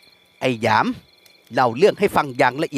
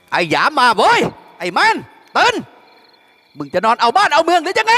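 blaring call; 10 semitones higher than usual. A narrator's reading. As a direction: blast it out to wake the whole house. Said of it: "แหกปากร้องก้องอีกว่า".

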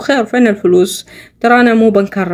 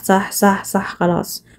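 grudging mumble; 3 semitones lower, 6 dB quieter, much slower than usual. Acted. Grudging agreement trailing off.

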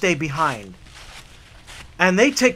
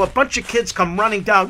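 usual speaking voice

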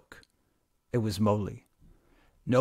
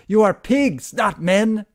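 loud, high furious yell; furious calling after; 10 semitones higher, 9 dB louder, slower than usual. A narrator's reading. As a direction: drive them out with a furious cry. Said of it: "she called after them".

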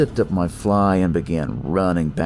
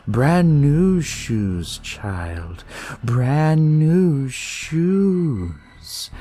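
put-on creepy cult kid; slower than usual. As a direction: relish the new shoes like a sermon. Cult kid voiced in story.

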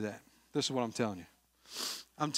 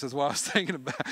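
usual speaking voice

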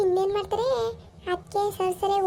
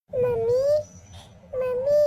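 high, raised calls for mommy; calling out for mom; 6 semitones higher, much slower than usual. Acted. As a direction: call out to mom from the doorway. Daughter calls mother from outside.

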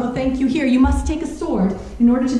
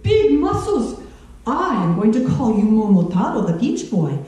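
ordinary talk, nothing marked

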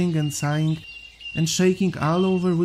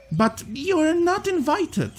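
much higher, slow little voice; hare voice; 12 semitones higher, slower than usual. Acted. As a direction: pitch it way up and drawn out. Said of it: "said the hare".

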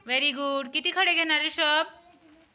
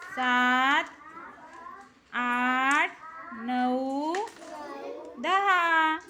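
slow, drawn-out count; counting aloud; much slower than usual. Real, not acted. Teacher leads a pupil's counting.

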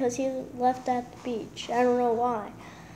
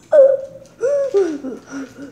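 loud sharp gasp from sourness; sour gasp; 4 semitones higher, 6 dB louder than usual. Real, not acted. Sour candy reaction.

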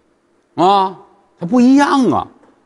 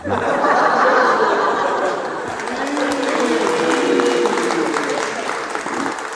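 audience laughter; 7 semitones higher, 5 dB quieter than usual. Real, not acted. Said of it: "拿走我也得追你去".

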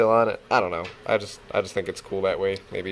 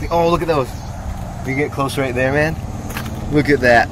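excited; 5 dB louder than usual. Real, not acted. Admiring the crystal up close.

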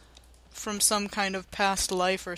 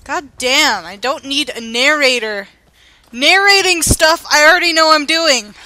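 loud, higher annoyed outburst; exasperated rant; 6 semitones higher, 15 dB louder than usual. Acted. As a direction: vent at the voice in your head. Annoyed at in-game narrator.

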